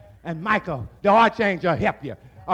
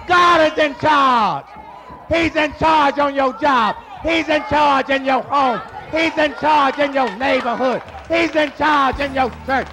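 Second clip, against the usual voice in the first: preaching at full force; 3 semitones higher than usual. Acted. Preacher declares God's rule to the congregation, shouted. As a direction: declare it with authority.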